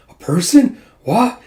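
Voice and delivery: high startled yelp. Retold or imitated. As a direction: caught off guard, rising. Startled mid-leap.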